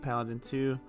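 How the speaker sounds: ordinary delivery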